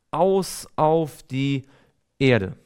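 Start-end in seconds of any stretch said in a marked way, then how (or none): none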